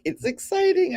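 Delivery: sudden high pitch jump; excited. Real, not acted. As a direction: pop the word up with excitement. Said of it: "I'm excited".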